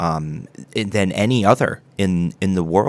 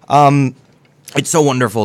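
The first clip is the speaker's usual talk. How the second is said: dry mouth; 7 semitones higher, 6 dB louder than usual. Acted. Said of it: "you sound a little, like there's something a little wrong".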